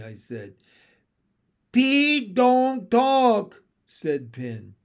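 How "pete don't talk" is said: high, loud frog voice; frog husband pleading. Acted. Frog husband begs wife for silence, high and loud. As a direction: blurt it high and loud, garbling the plea.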